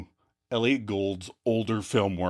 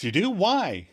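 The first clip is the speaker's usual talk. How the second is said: high, alarmed questions; hammed-up nervous surprise; mock panic; 6 semitones higher than usual. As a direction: blurt it in pretend alarm. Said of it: "And Chandler panics".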